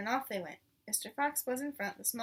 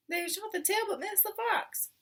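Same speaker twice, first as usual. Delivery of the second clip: upstairs voice; 9 semitones higher than usual. Acted. Voice calling from upstairs.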